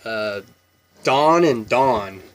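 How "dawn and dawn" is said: slow dawn and dawn, louder; drawn-out dawn and dawn, both as dahn; contrasting pronunciations. Shown as a demonstration. Setting up the name-versus-word contrast, slowly. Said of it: "We say dawn as D O N".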